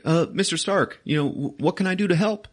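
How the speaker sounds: high-pitched, hesitant offer; eager kid sidekick. Retold or imitated. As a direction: imitate an eager boy stumbling over his words. Peter timidly offers Iron Man help.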